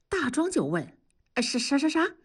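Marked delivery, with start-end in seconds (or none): 1.4-2.2 higher, stuttering disbelief; startled stammer. Acted. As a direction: trip over the word in surprise. Startled reply to leave request. (惊讶得结巴)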